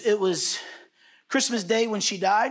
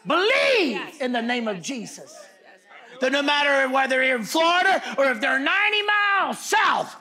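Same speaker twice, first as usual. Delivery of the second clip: full-force preaching; 4 semitones higher, 4 dB louder than usual. Real, not acted. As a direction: cry out the call to believe.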